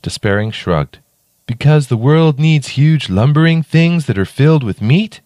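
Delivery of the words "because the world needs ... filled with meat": a bit higher, deadpan eager raven; lightly voiced odd raven. A narrator's reading. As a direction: shrug it off like a morbid little raven. Raven character voice.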